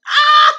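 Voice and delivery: high, loud burst of laughter; big real laugh. Real, not acted. Streamer cracking up at a viewer's comment, loud high laugh.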